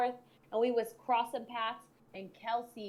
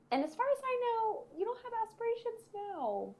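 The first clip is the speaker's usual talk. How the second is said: mocking; 13 semitones higher than usual. Taunting former high-school bullies, mocking.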